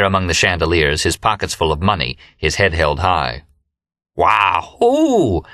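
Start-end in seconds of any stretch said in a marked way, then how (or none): 4.2-5.4 howl. A narrator's reading. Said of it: "howled Remy".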